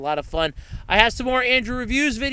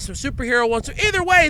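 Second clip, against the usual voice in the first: ordinary delivery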